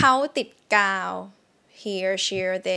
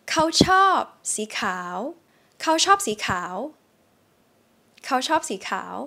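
dictation sentence; 4 semitones higher, much faster than usual. Shown as a demonstration. Teacher reading a test sentence for students. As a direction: read เขาชอบสีขาว deliberately. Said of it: "I'm gonna say a sentence".

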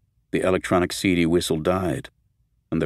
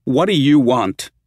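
high, slow, loud angry demand; calm turning angry; 6 semitones higher, 7 dB louder than usual. Acted. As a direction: rise into anger, slow and loud. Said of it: "her voice rising in anger".

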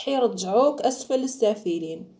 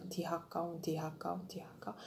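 quiet muttered insults; mock insult, murmured; 2 semitones lower, 13 dB quieter than usual. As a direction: mutter the insults under breath. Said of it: "ويقول لك".

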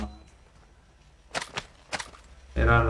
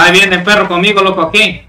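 urgent callout; 6 semitones higher, 6 dB louder than usual. Real, not acted. Calling out approaching enemy.

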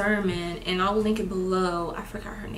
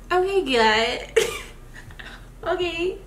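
resigned okay; 10 semitones higher than usual. Retold or imitated. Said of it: "And I was like".

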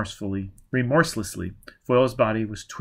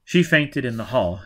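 plain reading voice

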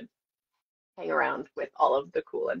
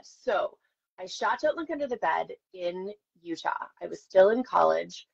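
ordinary delivery

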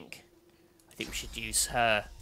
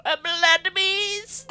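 very high, loud drawn-out cry; over-the-top, high-pitched proclamation; hammed-up summoning; 9 dB louder, much slower than usual. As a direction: shriek it slowly in mock triumph. Mock-summoning the blood beast.

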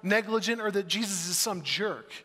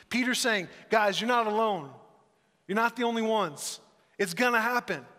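louder, higher urgent preaching; fervent preaching; 5 semitones higher, 5 dB louder than usual. Acted. A pastor telling his church Peter's words, fervent and loud. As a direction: press the promise home.

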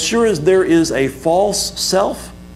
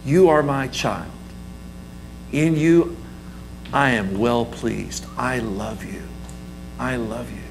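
gentle fatherly blessing, fading much softer; tender voice of God; 4 semitones lower, 5 dB quieter than usual. Acted. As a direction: speak it like a loving father to his child. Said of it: "your Heavenly Father saying that to you".

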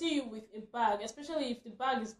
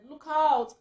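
louder warning call; retold warning shout; 5 semitones higher, 11 dB louder than usual. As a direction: call out a warning from the other side. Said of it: "a woman just shouted".

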